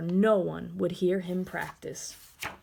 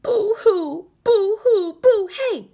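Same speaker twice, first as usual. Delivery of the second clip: much higher, louder shout; shouting boo; 12 semitones higher, 10 dB louder than usual. Acted. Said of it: "he shouted".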